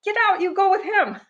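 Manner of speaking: very high-pitched re-enacted comeback; retold indignant yelling. Mother yells back at her daughter to get out, re-enacted high-pitched fury.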